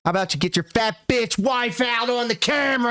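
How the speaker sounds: high, strained mock demand; heckling outburst. Reactor interrupting the streamer's goal plan, high jeering heckle.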